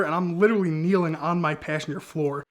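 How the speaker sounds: normal talking voice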